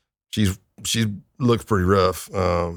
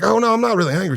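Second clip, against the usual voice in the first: mock sweet denial; 12 semitones higher, much faster than usual. [name] answers sweetly.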